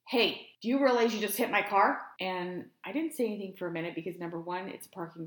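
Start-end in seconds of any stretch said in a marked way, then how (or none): 0.1-2.0 higher, louder accusing challenge; confrontational stranger. Retold or imitated. Driver confronting woman beside his car, accusing. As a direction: call out an accusation, retold. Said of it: "this person in the car next to me".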